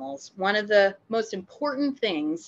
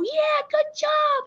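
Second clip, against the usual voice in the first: mock high, slow, drawn-out good job; exaggerated high praise; 4 dB louder, much slower than usual. Trainer praising from far away, exaggerated high. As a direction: sing it out high and slow, hammed-up. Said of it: "I can go".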